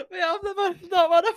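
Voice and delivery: high, squeaky laughing speech; real laughing fit, pitch shooting up; helpless laughter. Real, not acted. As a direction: barely get the words out through laughter. Cracking up at the punchline.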